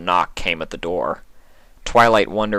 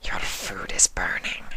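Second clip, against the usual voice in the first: whispering changeling; 7 dB quieter than usual. Acted.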